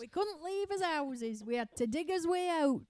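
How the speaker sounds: hammed-up Yorkshire grumble; playful Yorkshire old-timer accent; mock Yorkshire accent. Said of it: "do the accent".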